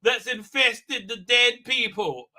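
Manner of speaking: slow, high, loud hammed-up explanation; mock dramatic reveal. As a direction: spell it out with mock grandeur, drawing words out. Acted explanation to the king.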